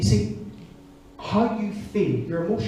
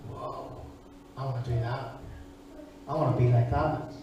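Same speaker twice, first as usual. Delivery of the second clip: put-on childlike awe; 5 semitones lower, 7 dB quieter than usual. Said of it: "and thought".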